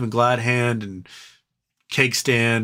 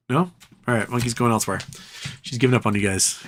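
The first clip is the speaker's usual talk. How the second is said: ordinary delivery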